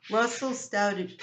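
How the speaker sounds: ordinary delivery, nothing marked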